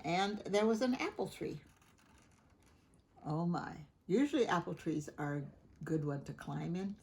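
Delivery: ordinary delivery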